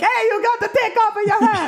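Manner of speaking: high cartoon Italian shout; Mario voice. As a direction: yell like Mario.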